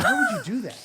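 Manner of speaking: exasperated, imitating an angry father; angry dad. Dad confronting his child over the cash prank, angry. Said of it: "He's, like, angry".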